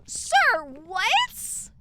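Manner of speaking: high, loud disbelief; shocked yell of disbelief; incredulous shout. Real, not acted. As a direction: sir what, stunned.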